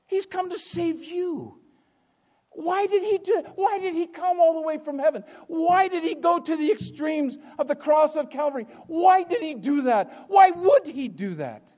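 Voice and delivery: high, loud rhetorical questions; full-force preaching. Acted. Preacher asking why Christ came to save, full-force. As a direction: build the questions into pulpit cries.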